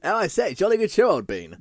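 high, loud upper-class toff; hammy old-school posh English accent; posh old-fashioned English. Pretend. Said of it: "old-fashioned B B C News voice".

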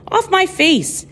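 sudden high exclaim; exclaiming at cat. Acted. Poet shooing the cat off her face, high sharp exclaim.

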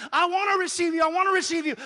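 very high, loud plea; fervent shout. Acted. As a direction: cry it out with longing. Peter walking toward Jesus.

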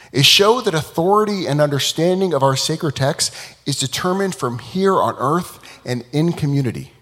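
ordinary talk, nothing marked

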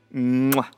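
a loud, smacking air kiss; blown kiss. Real, not acted. Praising singers who sing in dialect, blowing a kiss. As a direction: blow a big kiss at the mic.

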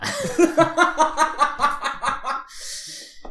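high burst of real laughter; loud laughing burst. Real, not acted. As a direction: crack up loudly. Laughing at text from friend.

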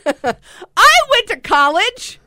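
high, loud, slow laughing protest. Real, not acted.

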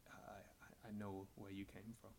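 quiet, hesitant character voice; hushed, halting admission; bashful. Acted. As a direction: speak softly with halting pauses, eyes on your toes. Game master voices hooded bashful man.

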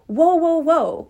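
taken-aback protest. Retold or imitated. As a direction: pull everything up short, high and loud. Acts out own objection to claims.

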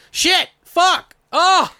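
high, loud bursts of swearing; exasperated cursing. Real, not acted. Host cursing at own slip, exasperated outburst. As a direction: yelp out each curse.

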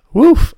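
loud laughing release, high pitched; big laugh and woof. Real, not acted. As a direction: let out a laugh then blow air. Laughs out after the rant.

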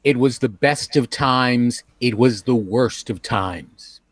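dramatic reading. Shown as a demonstration. Reading a famous line for lip game.

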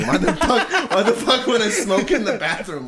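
high, loud, laughing protest; indignant denial. Retold or imitated. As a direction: protest incredulously through laughter.

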